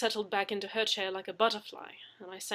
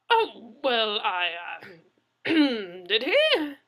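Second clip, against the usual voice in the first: flustered scramble; 3 semitones higher, 12 dB louder, much slower than usual. Acted. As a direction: stumble for words, caught out.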